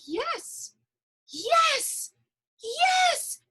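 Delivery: building, high-pitched elation; rising to an ecstatic cry; mounting excitement. Retold or imitated. Acting out her enthusiastic embrace.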